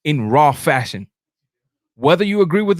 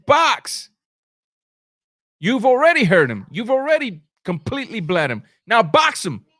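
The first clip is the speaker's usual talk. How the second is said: corner shouting; 5 semitones higher than usual. Retold or imitated. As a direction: bark it like a cornerman from ringside. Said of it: "The corner's telling him".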